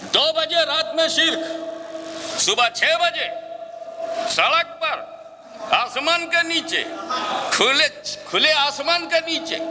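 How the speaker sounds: high, rising preaching; preaching at full force. Acted. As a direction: build to the crowd. (ऊँची, चढ़ती तक़रीर)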